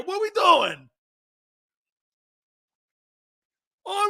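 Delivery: loud, high East Coast rant; fired-up East Coast rant; East Coast slang. Acted. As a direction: rant like the Jersey guy he used to be. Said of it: "go back to my stupid way I used to talk".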